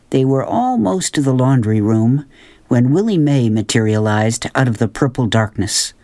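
usual speaking voice

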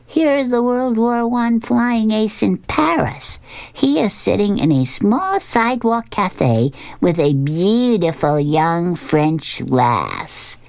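high, slow self-narrating cartoon dog; drawn-out, put-on flying ace daydream; Snoopy. A narrator's reading. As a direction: narrate your own heroics grandly, starting high and slow. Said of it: "And Snoopy says".

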